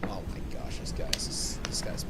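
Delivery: breathless exasperated whisper; whispered aside. Real, not acted. Muttering to herself about the boyfriend, whispered disbelief.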